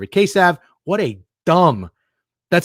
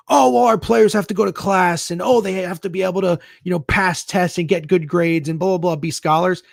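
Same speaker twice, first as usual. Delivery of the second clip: whiny, higher mock of a coach making excuses; 5 semitones higher than usual. Fan mocking the team's excuses, whiny mock voice. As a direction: sneer it like a school making excuses for lost recruits.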